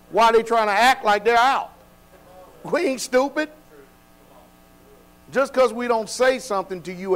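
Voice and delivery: rising, scolding sermon voice; heated preaching. Acted. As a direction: chide the flock with fire.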